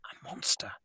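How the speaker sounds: breathless whisper. Acted. Lily hiding behind a tree, seeing the pilot, whispered. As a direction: hush it so the alien can't hear.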